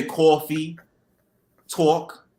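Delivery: New York "coffee" and "talk", vowels rounded; New York accent. Shown as a demonstration. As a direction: show off your New York pronunciation. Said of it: "it's the way I pronounce words".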